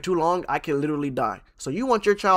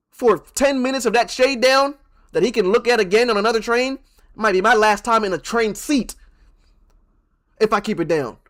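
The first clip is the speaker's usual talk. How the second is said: heated rant; 8 semitones higher, 6 dB louder than usual. Real, not acted. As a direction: hammer the point home. Ranting on the stakes.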